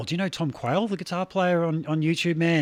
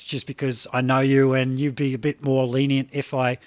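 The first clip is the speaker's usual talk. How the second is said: ordinary talk, nothing marked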